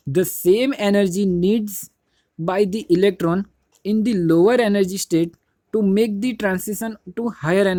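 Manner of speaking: reading the book line very slowly, word by word; slow read-along. Shown as a demonstration. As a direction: dictate each phrase slowly so the class can follow. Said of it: "तो मेरे साथ देखना". (किताब की line बहुत धीरे-धीरे, शब्द-शब्द पढ़ना)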